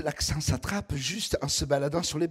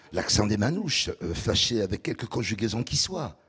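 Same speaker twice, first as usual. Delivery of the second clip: deep velvety southern voice; 6 semitones lower than usual. Pretend. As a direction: put on a deep velvet voice, like a charmer. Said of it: "un velouté". (voix grave et veloutée, ton chaleureux)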